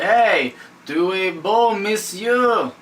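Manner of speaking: lightly hammed-up French flattery; French accent. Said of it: "Even the French put a word in there".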